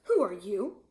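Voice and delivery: loud shouted lion question; lion yells. A narrator's reading. Lion yells at my father, loud. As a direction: bellow the question at the boy. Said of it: "the lion yelled at my father".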